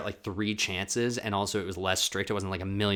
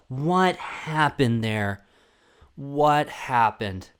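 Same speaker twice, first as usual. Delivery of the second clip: slow mock reporter; 4 semitones higher, 5 dB louder, much slower than usual.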